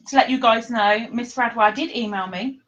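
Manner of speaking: ordinary talk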